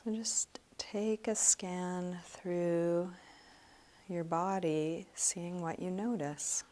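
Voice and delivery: soft, slow meditation voice; guided meditation. Real, not acted. Meditation teacher leading students through a body scan, soft and slow. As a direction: guide them gently through a body scan, leaving long pauses.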